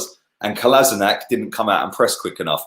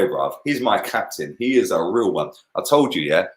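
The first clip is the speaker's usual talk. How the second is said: normal talking voice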